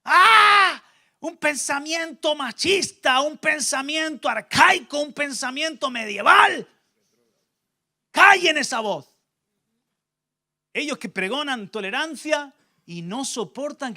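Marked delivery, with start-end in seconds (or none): 0.0-9.1 much higher, loud scandalised squeal; the preacher mimicking critics crying sexist; mock outrage. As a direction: parody the world's shocked indignation. Mocking the world's outrage.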